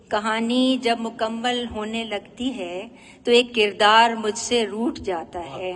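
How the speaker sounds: measured nazm recital, each phrase set apart; poetry recitation. Acted. Poet presenting a nazm about drawing eyes, poetry recitation. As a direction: recite line by line with lingering endings.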